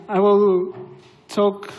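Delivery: ordinary talk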